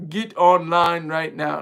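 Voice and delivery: put-on loud, drawn-out botched African accent; terrible drill-instructor impression with an African-sounding accent; bad African-sounding accent. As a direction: bark it slowly in a failed Jamaican-turned-African accent. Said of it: "It sounded like an African accent".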